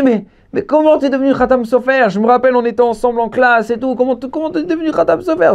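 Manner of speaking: high, incredulous rush of questions; hammed-up high-pitched disbelief; acted astonishment. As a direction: gasp it out as a stunned old classmate. Acted astonished old classmate.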